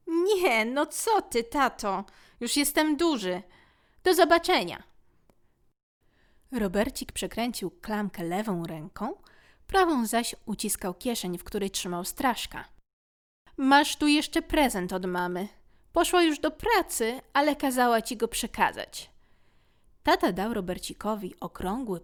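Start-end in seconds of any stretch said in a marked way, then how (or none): 0.1-4.8 put-on high, brushing-off child voice; embarrassed young son; proud boy. Boy refusing a hug. 13.6-19.1 a hint of an affectionate dad; kind father. Father handing a gift.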